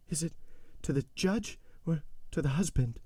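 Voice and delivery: high, faltering question; frightened stammer. A narrator's reading. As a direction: stammer it out, terrified. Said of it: "stammered Madame de Villefort".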